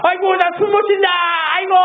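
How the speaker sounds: put-on old man groaning. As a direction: wail like the old man who can't breathe. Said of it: "계속 이래요". (숨 못 쉰다고 높게 앓는 할아버지 흉내)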